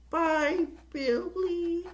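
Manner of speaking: very high, small creature voice; tiny Gizmo voice. Retold or imitated. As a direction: squeak a sweet goodbye like a little Mogwai. Gizmo says goodbye to Billy.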